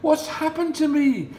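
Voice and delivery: high, loud amazed duckling; astonished. Acted. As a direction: gasp it in wonder. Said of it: "in astonishment".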